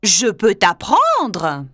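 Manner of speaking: deep panther voice. Acted. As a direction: speak low and steady. Bagheera voice.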